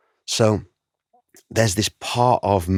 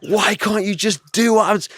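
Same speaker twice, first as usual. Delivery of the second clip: much higher, half-laughing mock rant; mock angry dad; 13 semitones higher, 3 dB louder than usual. Dad mocking his past self scolding, hammed-up. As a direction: send up his own frustration. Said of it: "I get so mad".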